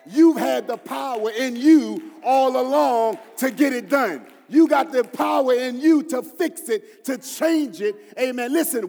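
loud preaching shout, driving each phrase home; full-force preaching. Acted. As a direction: preach it to the back row.

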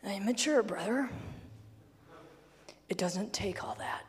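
hushed mock complacency; smug mature believer. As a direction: whisper it like a self-satisfied elder. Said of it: "this pass called".

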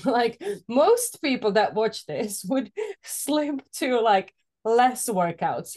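amused and drawn-out, laughter breaking in; laughing. Real, not acted. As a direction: laugh your way through the explanation. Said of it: "I'm laughing because".

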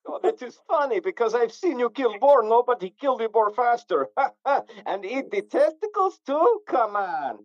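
mock Slavic accent, egging him on; Eastern European accent. Friend praising the boar killer, in mock Eastern European accent. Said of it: "I do a mean Eastern European accent".

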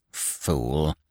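low, soft, irritable snap; snapped insult. A narrator's reading. Injured Scaramouche to the laughing Harlequin, snapping. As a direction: spit it out under the breath. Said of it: "Scaramouche snapped".

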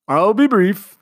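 a pompous commander promising brevity; commander's voice. A narrator's reading. Commander addressing the household, pompous.

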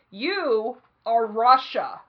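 very slow, loud pronouncements; mock-solemn, one word at a time; teacher assigning countries. Teacher dividing the class into nations, slow and loud. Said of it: "He's like".

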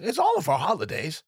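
ordinary delivery

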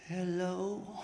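soft, sheepish hello with a laugh; embarrassed and caught out. Retold or imitated. Husband taking the phone from the pastor, sheepish and soft. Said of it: "sheepishly kind of said".

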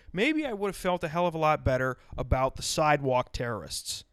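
ordinary talk

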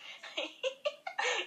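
shrill burst of laughter; high laugh. Real, not acted. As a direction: cackle high. Laughing at own joke. (carcajada aguda)

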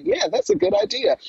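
squeaky high mocking yes-man; mock eager agreement. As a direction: parody a giddy producer agreeing. Mocking the show creators agreeing.

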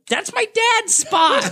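put-on high, slow, laughing kid's voice; squeaky child staking a claim; little kid voice. Seven-year-old chiming in at the wrestling, piping up high and slow. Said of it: "some little seven-year-old chimes in".

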